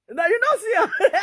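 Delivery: high, loud laugh through the words; can't stop laughing telling it; real amused laugh. Real, not acted.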